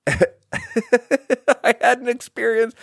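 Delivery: words squeezed out through laughter, slow; giggling so hard the words stretch; laughing. Real, not acted. To the podcast audience, laughing while starting a story.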